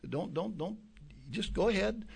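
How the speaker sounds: softly, half-whispered, kindly letting them go; a soft pastor's shrug, no pressure; gentle advice. Retold or imitated. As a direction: ease them out the door gently. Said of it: "just gently saying".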